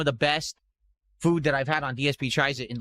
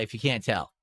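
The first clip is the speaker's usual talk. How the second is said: normal talking voice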